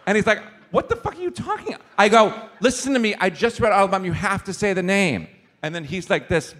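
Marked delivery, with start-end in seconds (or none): 0.7-1.8 high, incredulous squawk; mock the host's total confusion; baffled disbelief. Host baffled by claim. 2.6-5.3 higher, louder earnest insisting; insistent. Retold or imitated. Insisting to the host.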